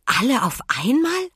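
high astonished question; amazed. A narrator's reading. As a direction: marvel at it. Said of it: "staunte Emma".

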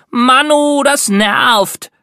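put-on high, loud whiny complaint from a little girl; complaining little sister. A narrator's reading. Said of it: "beschwerte sich meine kleine Schwester Lilly". (genervte kleine Schwester, hoch und laut)

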